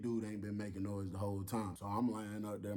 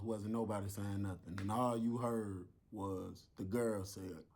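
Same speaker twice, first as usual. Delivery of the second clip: ordinary delivery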